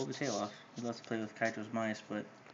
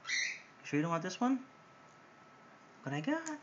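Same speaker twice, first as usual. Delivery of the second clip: much higher sing-song coaxing; coaxing pet talk; 7 semitones higher than usual. Real, not acted. Owner tempting Helix with another toy, high coaxing. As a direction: lure the bird with a squeaky, rising got.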